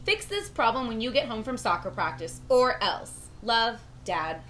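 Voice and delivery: plain reading voice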